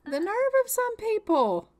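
high, loud indignant cry; high-pitched indignation; indignant outburst. Real, not acted. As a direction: huff it out, scandalized. Outrage at the fired realtor.

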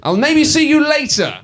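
high, loud parody of the sign-off line; mock echo. Host parroting the character's goodbye, high and loud. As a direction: throw the line back up high and loud, mocking.

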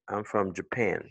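"Japan" as juh-PAN at the end; weak "juh", stressed PAN in "Japan"; correct schwa in Japan. Shown as a demonstration. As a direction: model the sentence with English-sounding "Japan". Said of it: "c'est Je".

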